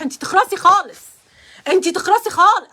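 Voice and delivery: loud playful shut-up to a friend; mock scolding. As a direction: tell her off loudly, half joking. Joking scolding at friend Wafaa.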